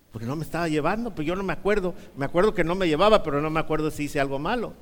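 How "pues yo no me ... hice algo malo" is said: ordinary talk, nothing marked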